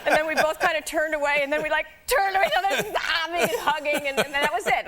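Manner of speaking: laughing through the words, high; giggling as she tells it. Real, not acted. Laughing while telling emotional goodbye.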